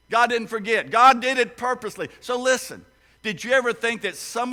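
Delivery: booming pulpit emphasis; loud insistence. Real, not acted. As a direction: drive the point home with force. Preacher insists loudly.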